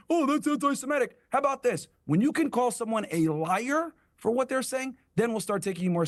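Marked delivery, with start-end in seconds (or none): 0.1-1.2 mocking quote. Mocking rabbi's complaint.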